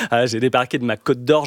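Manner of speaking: usual speaking voice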